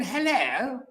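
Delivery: high, laughing posh Englishman; high posh English greeting, laughing; posh English accent. Retold or imitated. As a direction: say it like a plummy English gent. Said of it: "Germans have English accent, very posh".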